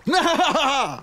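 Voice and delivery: hammed-up evil laugh; stage villain laughing. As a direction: cackle theatrically.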